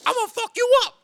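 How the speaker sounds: loud, squeaky-high mock threat; high-pitched Westbrook impression. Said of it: "with his high-pitched voice all the time".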